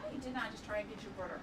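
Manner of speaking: ordinary delivery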